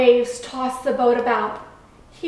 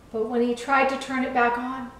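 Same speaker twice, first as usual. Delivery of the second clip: ordinary delivery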